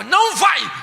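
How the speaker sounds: sharp loud high no; stern refusal. Retold or imitated. Strict father refusing his daughter, loud and sharp. Uncle forbids cousin going out.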